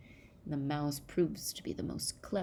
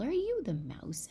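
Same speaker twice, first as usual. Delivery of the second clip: higher, lightly voiced child's question; storybook child; 9 semitones higher than usual. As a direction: ask in a hint of a high child voice.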